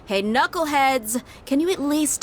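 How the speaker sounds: calling out. Real, not acted. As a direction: cut through their squabbling.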